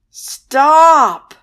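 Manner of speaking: very loud, high shout; exasperated boy yelling stop. A narrator's reading. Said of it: "Alex yelled".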